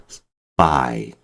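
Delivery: 스파이 with the p made tense, like ppa; 스파이 low and soft; tensed spy. Shown as a demonstration. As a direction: say 스파이 as s-ppa-i, no puff of air. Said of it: "스파이가 아니라 스파이".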